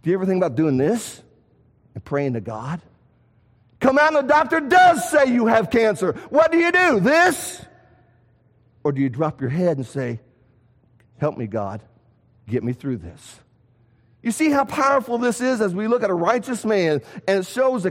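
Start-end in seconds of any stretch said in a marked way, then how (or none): 3.8-7.6 preacher pressing the congregation; rising preacher's challenge. Acted. Preaching, acting out panic. 11.2-13.4 much lower and softer plea; soft humble plea to God; humble whispered-down prayer. Acted.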